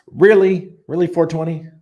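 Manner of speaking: stunned, wants it confirmed; surprised disbelief. Real, not acted. Reading a chat claim about R H, loudly surprised. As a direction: pitch jumps high and loud on Really.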